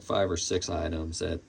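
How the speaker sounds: normal talking voice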